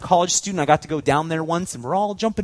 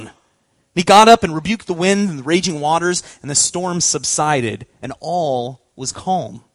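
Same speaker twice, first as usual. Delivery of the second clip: louder, measured Bible reading; a lightly dramatized sermon reading, slowing at the calm; scripture reading; 4 dB louder than usual. Acted. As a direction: read the Gospel to the congregation. Reads Gospel passage aloud.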